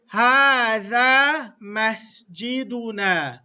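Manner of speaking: slow, careful "هذا مسجدنا" with each syllable clear; slow model phrase. Shown as a demonstration. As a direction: say "هذا مسجدنا" slowly for students to copy. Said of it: "this is how you do this. Pay attention".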